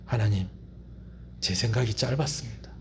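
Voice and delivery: low, hushed confession; acted contrition, voice dropped well down; humble repentance. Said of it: "요나가 어떻게 해야 돼요?". (낮고 조용하게 뉘우치는 고백)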